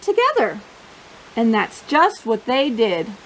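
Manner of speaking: lively picture-book read-aloud voice; bouncy storybook narration for a young audience; reading to children. Acted. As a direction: read it like storytime for little kids.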